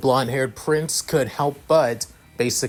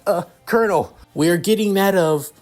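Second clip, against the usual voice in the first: higher, tense report; nervous operator; 7 semitones higher than usual. Acted. Operator reporting to the colonel, higher uneasy voice. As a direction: report bad news you don't understand.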